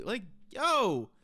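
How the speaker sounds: stunned damn. Real, not acted. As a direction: let the damn land in disbelief. Shocked reaction.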